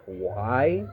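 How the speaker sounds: usual speaking voice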